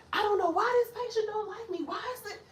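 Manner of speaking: put-on high, plaintive voice of a puzzled old patient; whiny patient. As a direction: complain like a confused older patient. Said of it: "they be like".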